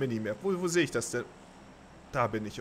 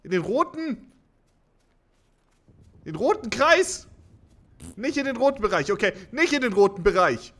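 panicked yelling; 8 semitones higher, 9 dB louder than usual. Real, not acted. Confused panic over red zone.

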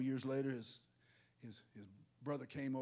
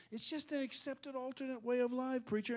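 lightly voiced higher-pitched excuse-maker; a hint of a pleading church member, pitched up; mildly mocking, raised voice; mock objector; 10 semitones higher than usual. Voices someone excusing sin to preacher.

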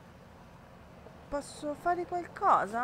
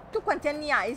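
ordinary delivery

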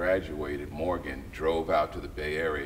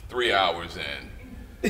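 weary sigh; much slower than usual. Retold or imitated. Exhausted by long prayers.

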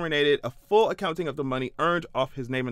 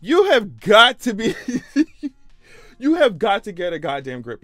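exasperated outburst; 4 semitones higher, 7 dB louder than usual. Real, not acted. Vlogger losing patience with the Tuohys, loud laughing outburst. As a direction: yell it, then crack up.

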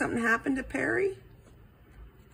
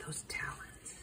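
hushed, voiceless trailing whisper; faint whisper; 7 semitones lower, 18 dB quieter than usual. A narrator's reading. Narrator on the flashed talons, whispering. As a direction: whisper it very softly. Possum frightened by talons again.

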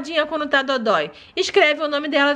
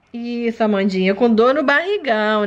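normal talking voice

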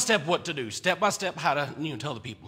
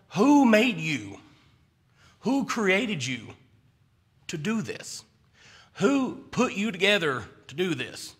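annoyed God; 3 semitones higher, slower than usual. Retold or imitated. God voiced annoyed, slow.

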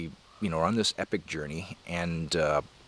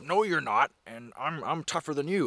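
higher-pitched, playful comeback; mock defiance; 6 semitones higher than usual. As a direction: act out a brawler squaring up. Acting defender squaring up to the bully.

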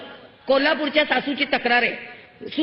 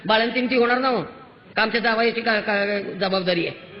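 ordinary talk

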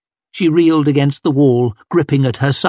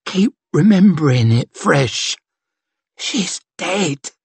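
halting, hushed and close to tears; tearful grief; 5 semitones higher than usual. A narrator's reading. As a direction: let it fade into a broken whisper. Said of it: "squeezed tears down his pale cheeks".

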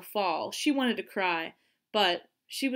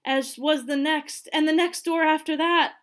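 building panic; 7 semitones higher, 6 dB louder than usual. A narrator's reading. Narrator building panic at locked doors.

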